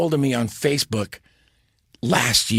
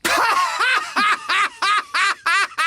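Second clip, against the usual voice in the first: big laugh. Real, not acted. Laughing at own story.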